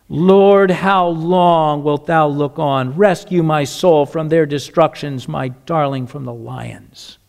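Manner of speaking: low, slow lament to the Lord; pleading prayer. Acted. David pleads with God for rescue, low and fading.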